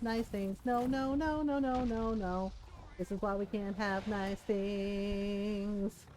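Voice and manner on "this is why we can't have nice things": slow, soft, resigned; slow resigned drawl. Real, not acted. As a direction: drag it out in defeat. Repeated, slowed.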